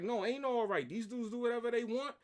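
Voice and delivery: high-pitched indignant outburst. Retold or imitated. Worker rejects boss's calming words, going crazy. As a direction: cut him off, outraged, pitch way up. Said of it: "I turned it to [name]".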